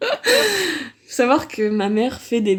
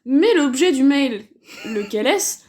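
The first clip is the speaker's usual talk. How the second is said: normal talking voice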